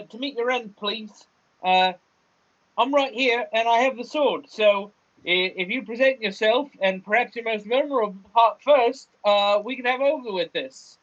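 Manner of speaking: high, halting character voice; Gan challenging the cave. Acted. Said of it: "enters into the cave and says".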